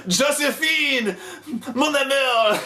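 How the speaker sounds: high, slow mock death cry; Napoleon. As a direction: melodramatic French last words. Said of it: "grabs his throat and blood spurting out. He's like".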